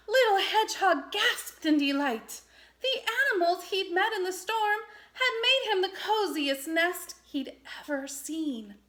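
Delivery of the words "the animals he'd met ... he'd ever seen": usual speaking voice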